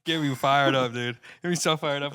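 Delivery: loud, riled-up outburst; getting worked up and loud; fired up. Real, not acted. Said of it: "I start losing track of my voice".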